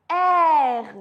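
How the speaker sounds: French R, vibrating at the back of the tongue; gargled French R. Shown as a demonstration. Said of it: "It's at the back".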